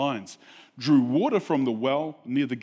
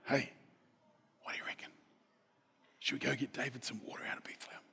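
conspiratorial whisper; 2 semitones lower, 15 dB quieter, faster than usual. Retold or imitated. Warriors plotting a secret plan.

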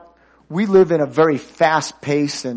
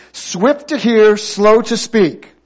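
slow, loud, raised call to repeat; leading congregation in recitation; 6 semitones higher, 7 dB louder, slower than usual. Shown as a demonstration. Preacher has everybody say it with him, loud recitation. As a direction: lead the room slowly and loudly in unison. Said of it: "say it with me".

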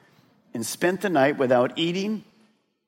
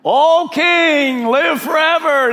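high, loud call up from the pit; joyful call; 14 semitones higher, 9 dB louder, slower than usual. Retold or imitated. Daniel calls up from the lions' den, loud and high. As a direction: shout up to the king, full of relief. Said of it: "to hear this sound".

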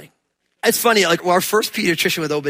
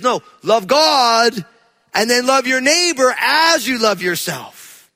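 slow solemn quote; 4 semitones higher, 3 dB louder, slower than usual. Acted. Quoting Jesus in sermon.

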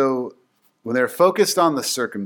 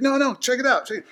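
high excited showing off; 11 semitones higher, faster than usual. Retold or imitated. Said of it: "he could be like".

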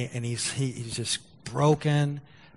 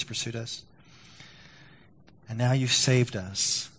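closing prayer. Acted. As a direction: pray it reverently, letting lines fade. Pastor leads closing prayer.

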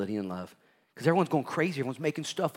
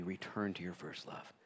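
tender plea; 3 semitones lower, 14 dB quieter than usual. Acted. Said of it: "rebuke to the church".

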